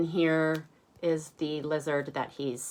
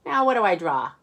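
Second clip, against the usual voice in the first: put-on puzzled kid; 5 semitones higher, 6 dB louder, faster than usual. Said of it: "then you go".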